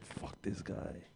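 slow, voiceless mock grumble; hostile whisper. Comic voicing an audience member who hates him, whispered. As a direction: whisper it slowly as the disgruntled onlooker.

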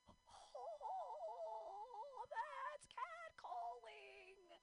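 high, very slow whiny drawl; hammed-up squeaky dismay; high whining realization. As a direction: whine it as the penny drops.

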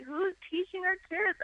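imitated husband. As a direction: ask it exasperated. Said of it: "It's like".

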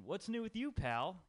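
high, cheery friend asking after you; a hint of a chipper buddy; chirpy catch-up question. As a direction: toss it off brightly like an old pal.

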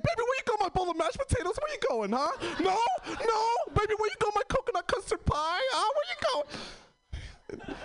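put-on calling after the girlfriend, high and pleading; mock pleading. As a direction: beg her to come back, voice up high. Pleading her back, retold.

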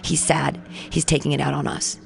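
low, soft, understanding aside; empathetic. Retold or imitated. Diner explaining the man came from a funeral, quietly empathetic. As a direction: excuse him gently, dropping the voice. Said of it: "I'm an empathy person".

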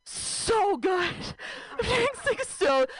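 high, overbright fake cheer; nervous chipper answer; forced cheer. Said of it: "I was just like".

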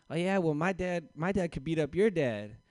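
mock playground dare; kid boasting. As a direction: taunt like a bragging kid. Kids' playground boast fight.

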